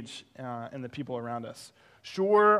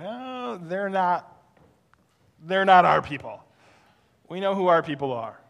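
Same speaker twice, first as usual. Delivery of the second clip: louder, snooty mock voice of their old attitude; mock snobbish; 3 semitones higher, 8 dB louder than usual. Pastor mocking his old snobbery to the congregation, loud and snooty. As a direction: sniff at the neighbors, hammed-up.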